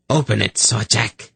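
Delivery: relentless order. A narrator's reading. Kabat overriding Zadi's plea, relentless. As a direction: give the order without yielding. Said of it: "Kabat ordered relentlessly".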